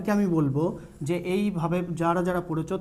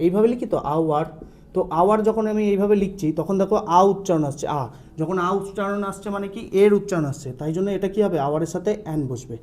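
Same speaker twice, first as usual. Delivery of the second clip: ordinary talk, nothing marked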